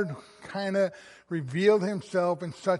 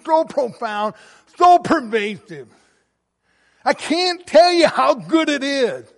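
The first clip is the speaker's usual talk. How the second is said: rising, louder, ecstatic preaching voice; full-force preaching; 6 semitones higher, 10 dB louder than usual. Acted. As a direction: preach the joy out, loud and high.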